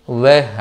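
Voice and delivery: slow, low "వెహ్", stretched e vowel; colloquial "వెహ్". Shown as a demonstration. Teacher summing up native pronunciation, "వెహ్" drawn out as veh. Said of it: "వాని వేగా పలుకుతారు".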